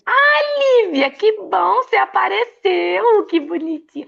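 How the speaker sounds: high, loud delighted welcome; a delighted hello to a guest; excited greeting. Real, not acted. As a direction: greet her with a burst of joy. Greeting a guest joining live.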